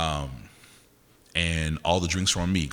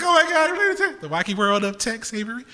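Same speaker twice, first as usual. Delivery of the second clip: mock gushing; 13 semitones higher than usual. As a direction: gush in a put-on high voice. Mocks own imagined gushing reaction.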